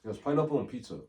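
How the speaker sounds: ordinary talk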